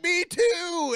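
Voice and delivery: very high, loud excited yell; old lady shrieks back in delight; mock joyful shout. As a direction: shout it like finding a long-lost friend. Old lady's joyful reply, retold.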